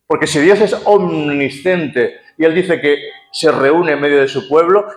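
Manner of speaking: ordinary delivery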